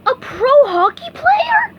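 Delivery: high, slow, thrilled squeak; can't believe his luck; amazed gasp. A narrator's reading. SpongeBob offered a pro contract, thrilled high squeak. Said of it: "SpongeBob gasped".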